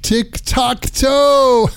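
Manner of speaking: high, drawn-out delighted echo. Real, not acted. Repeating punchline delighted.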